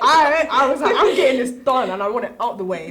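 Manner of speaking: loud laughing declaration. Retold or imitated. Student laughs loudly recalling early application.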